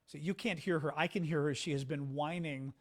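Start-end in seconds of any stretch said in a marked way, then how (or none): none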